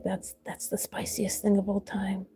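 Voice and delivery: slow, breathless secret whisper. Retold or imitated. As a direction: hush it like a guilty secret. Excited fangirl whisper to herself.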